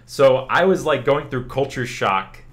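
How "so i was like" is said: ordinary talk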